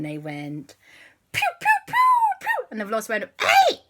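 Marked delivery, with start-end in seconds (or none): 1.3-2.7 very high, loud zapping noises; laser pew sounds. Acted. The children zapping the velociraptor, high laser pews. 3.4-3.8 very high, loud shocked cry; startled yelp. Acted. The ghost velociraptor struck by the zap, high startled yelp.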